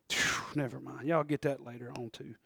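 quiet aside. Real, not acted. Aside, almost muttered.

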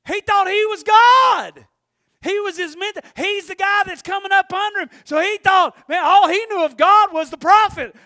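high, loud, excited preaching; full-force preaching. Real, not acted. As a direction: drive it home to the congregation.

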